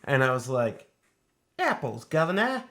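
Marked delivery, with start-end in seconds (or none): 1.6-2.6 put-on playful Cockney accent; a light Cockney accent. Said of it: "we do this Cockney accent".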